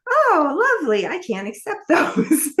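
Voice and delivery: high, strained politeness breaking into a laugh; fake polite reply. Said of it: "And I was like".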